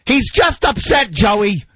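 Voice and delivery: mother defending crying son; mock mother voice. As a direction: imitate mother calming father. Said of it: "And he's like".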